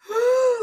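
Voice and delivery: sharp, high crying gasp; sobbing gasp. Retold or imitated. Retelling the dog eating the car seat, crying gasp. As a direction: gasp like she is starting to sob. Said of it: "like, sobbing".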